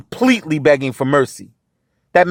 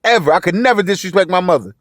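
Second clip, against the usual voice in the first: ordinary talk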